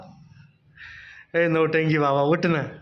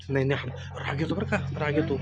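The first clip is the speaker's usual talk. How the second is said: hushed mock warning; 2 semitones lower, 6 dB quieter than usual. Teasing the sleepy boy.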